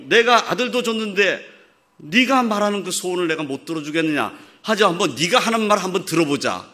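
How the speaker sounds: laughing, lavish father voice; generous loving God. Pretend. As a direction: offer it gladly like a doting father. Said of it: "그러지 않겠어요?".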